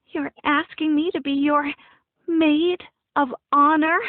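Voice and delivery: high, gooey overstress on "maid of honor"; gushing overstress. A narrator's reading. Friend asked to be maid of honor, gushing overstress. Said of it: "She overstressed the words in a really gooey way".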